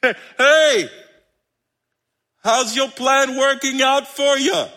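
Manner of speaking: high, slow, teasing holler; calling out. Acted. Jesus asking flailing fishermen about their plan, calling out. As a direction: holler over to someone far off.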